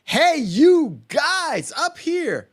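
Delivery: high, slow, drawn-out call; frustrated call. Retold or imitated. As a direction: call up slowly, high and exasperated. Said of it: "he's so frustrated".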